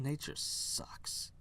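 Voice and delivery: ordinary delivery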